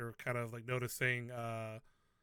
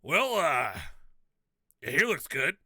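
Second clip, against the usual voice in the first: lightly voiced character, louder than narration; slightly louder in-character line; Motham; 8 dB louder, slower than usual. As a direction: speak up a little as the character, drawing out the first word. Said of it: "and he goes".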